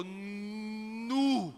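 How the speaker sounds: long drawn-out "Nu"; hammed-up, stretched gasp of wonder; amazed exclamation. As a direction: hold the word in comic astonishment. Mineiro amazed by huge cheese.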